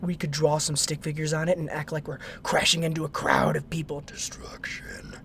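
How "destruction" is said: slow whispered relish; sinister whisper. Acted. Friend in the car savoring the crash, slow sinister whisper. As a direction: breathe the word out slowly like a villain.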